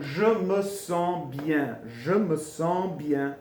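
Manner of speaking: "je me sens bien" very slow, word by word; stretched, careful "je me sens bien"; slow teaching demo. Shown as a demonstration. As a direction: model "je me sens bien" slowly for learners. Teacher models phrase slowly.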